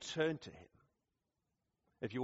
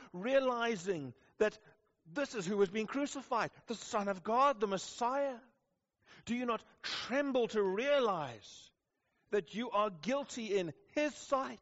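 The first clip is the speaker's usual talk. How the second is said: high, raised preaching voice pressing the question; fervent preaching; 8 semitones higher than usual. Acted. Preacher presses the congregation to fear God, fervent preaching. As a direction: plead with the room at full force.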